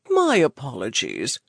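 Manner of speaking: a hint of a smarmy dealer; oozing. A narrator's reading. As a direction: ooze fake charm to win her over. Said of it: "he oozed".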